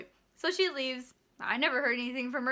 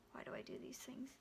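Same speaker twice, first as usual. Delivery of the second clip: breathy self-question, whispered; whispered aside; 19 dB quieter than usual. Real, not acted. Vlogger whispers to herself at the end, whispering. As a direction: whisper it to yourself.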